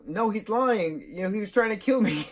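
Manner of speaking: high-pitched mock protest; acted tattling kid. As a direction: whine the accusation up high to mom. Acts out Greg protesting to mom.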